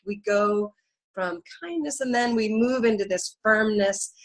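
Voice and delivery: usual speaking voice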